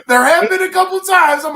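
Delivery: high, loud laughter breaking through the words; laughing hard. Real, not acted. Laughing at his cohost's job joke, high and loud. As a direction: crack up while trying to speak.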